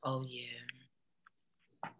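hushed knowing whisper. Real, not acted. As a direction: breathe it out under your breath. Knowing reaction to Marine.

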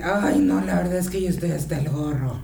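laughing mock fed-up mom; fed-up confession. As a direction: blurt out the honest exhaustion. Imitating a mom admitting exhaustion.

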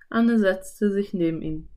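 ordinary delivery

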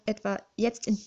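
plain reading voice

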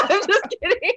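high laugh through the words. Real, not acted. Joking about GoFundMe, laughing. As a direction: giggle it out.

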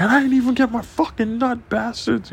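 very high-pitched mock complaint; whiny parting shot thrown back at cops; comic squealed grievance; retold heckling. Acts out yelling back at cops.

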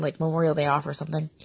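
ordinary talk, nothing marked

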